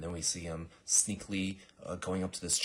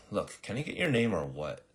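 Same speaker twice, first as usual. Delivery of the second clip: louder, exasperated acted question; impatient demand; 3 semitones higher, 5 dB louder than usual. Girl to speechless assassin, impatient demand. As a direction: press for an answer, fed up.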